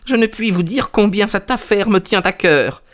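high, fervent little man; feverish eagerness. A narrator's reading. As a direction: pour out how much it matters, high. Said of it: "en agitant fiévreusement les mains".

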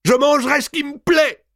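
high, loud furious shriek; the countess screams at her maid; screamed. A narrator's reading. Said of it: "hurla Mao".

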